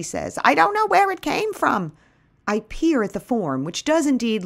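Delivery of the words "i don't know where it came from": put-on high-pitched elderly neighbor, puzzled; Bertie, troubled. A narrator's reading. Bertie explains the cocoon in the bird cage, troubled. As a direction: play a troubled old lady, pitched way up. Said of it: "Bertie greets me at the door with a troubled face".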